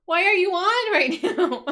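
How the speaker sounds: high, loud exasperated scolding; mad. Retold or imitated. Streamer scolding the front room light, comically mad. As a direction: scold a misbehaving gadget, half laughing by the end. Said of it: "I'm just so mad".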